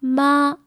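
"Ma" on one steady level pitch; flat tone. Shown as a demonstration. Teacher giving the Chinese word for mother, flat tone. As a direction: hold "Ma" even, without bending it. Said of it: "a flat tone".

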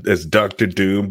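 ordinary talk

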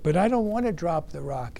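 usual speaking voice

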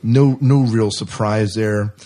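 ordinary talk, nothing marked

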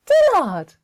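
name cried out high and loud; loud high call. Acted. Ma calling the name out loud, delighted. Repeated name, much higher.

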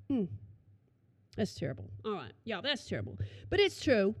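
normal talking voice

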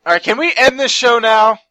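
loud, fed-up complaint; exasperated loud. Real, not acted. Exasperated plea to end show.

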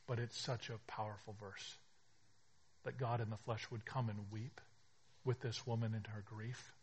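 ordinary talk, nothing marked

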